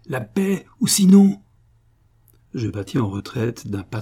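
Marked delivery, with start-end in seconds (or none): 0.0-0.6 much higher, the threat swelling; rising threat. A narrator's reading. Giant threatening the narrator. 0.8-1.4 much higher, much louder threatening yell; furious shout. A narrator's reading. Giant threatening the narrator.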